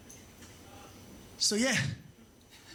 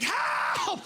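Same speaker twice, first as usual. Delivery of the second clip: panicked husband. Retold or imitated. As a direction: yelp it, high and panicked. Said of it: "And he's like".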